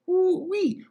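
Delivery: very high squeal of delight; excited whoop. Acted. Host whooping at the dance, high and excited. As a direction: let out a high ooh-wee.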